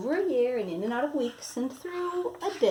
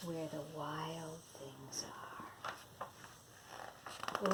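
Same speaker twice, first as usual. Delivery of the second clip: low, slow, softer hushed telling; 7 semitones lower, 16 dB quieter than usual. Acted. Grandma teasing the toddler with the title, low and hushed. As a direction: draw the child in with a hush.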